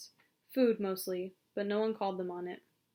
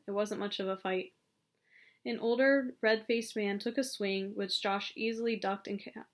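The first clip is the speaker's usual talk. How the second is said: ordinary delivery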